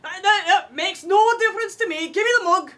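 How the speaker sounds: dwarf lass pushing for a taste; demanding dwarf. Acted. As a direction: insist on the mug, eager.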